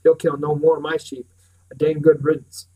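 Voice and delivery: loud, gloating and harsh; savage contentment. A narrator's reading. Pritchard gloating over the dead dog, loud and savage. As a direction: gloat loudly over the kill. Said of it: "savage contentment".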